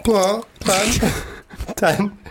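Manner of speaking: high, giggling answer; giggling rating; laughing. Real, not acted. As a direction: laugh out the score.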